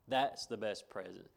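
ordinary talk

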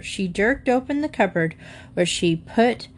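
plain reading voice

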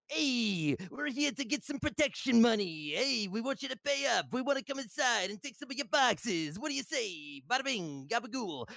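a hint of a cartoon mobster shaking them down; goofy mafia roleplay. Streamer retelling his gang's raid on a base, mild goofy mobster. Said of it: "the dumbest, uh, mafia roleplay".

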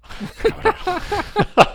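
high laugh burst. Real, not acted.

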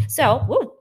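loud burst of real excitement; a spontaneous happy woo; an excited woo that almost topples the mic. Real, not acted. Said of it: "got excited".

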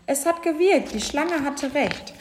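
high-pitched, cheering announcement; jubilant calling. A narrator's reading. Elephants cheering that the tickling worked, jubilant. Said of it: "riefen die Elefanten".

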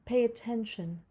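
usual speaking voice

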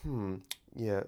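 soft, slightly unnatural British murmur; half-hearted British intellectual hum; attempted British NPR. Shown as a demonstration. Demonstrating what to go and say, unconvincing mock-British musing. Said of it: "that one wasn't as natural".

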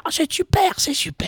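high-pitched, fading to a breathy whisper; high delighted gush. Retold or imitated. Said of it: "il aime bien".

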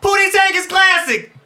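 high, slow, loud insistence; insisting. Real, not acted. Friend defending his pick in a movie debate, high and insistent. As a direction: plead the case for a favorite movie.

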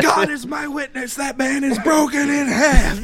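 high shouted ringside call; screaming announcer. Retold or imitated. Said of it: "You know, like, screaming".

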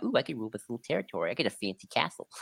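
fast, quiet, greedy aside; scheming ruler voice. Retold or imitated. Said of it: "sees herself as like".

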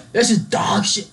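high-pitched mock chef's outburst; hammed-up angry put-down, no British accent; Gordon Ramsay. TV chef laying out a cook, mock angry yell. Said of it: "Gordon Ramsay will just yell at you".

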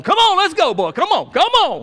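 high shouted giddy up; spurring a dead horse on; urging a horse. Pretend. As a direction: holler at the horse to get moving.

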